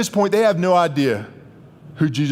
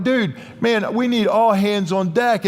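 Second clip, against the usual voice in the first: panicked disciples; 6 semitones higher than usual. Retold or imitated. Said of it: "And we're like".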